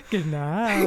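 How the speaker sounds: Southern drawled sign-off; put-on Southern farewell through a giggle; Southern accent. Shown as a demonstration. Showing how they say good night, Southern accent. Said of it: "or we say goodbye".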